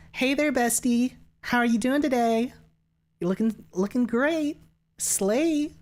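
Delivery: put-on high, gushing Valley Girl. Shown as a demonstration. Streamer greeting a bestie as a demo, high Valley Girl. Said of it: "is Valley Girl voice like this".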